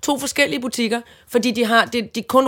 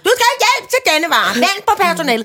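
much higher, insistent imitation of a till; mock automated alarm message; self-checkout machine; 8 semitones higher, 4 dB louder than usual. Till ordering the customer to wait, nagging machine voice. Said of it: "så siger den".

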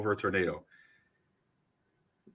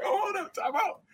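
high voice, quickening at the end; sudden realization; 4 dB louder than usual. Retold or imitated. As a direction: catch on that it's real. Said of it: "I'm like".

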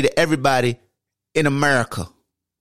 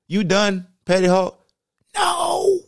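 ordinary talk, nothing marked

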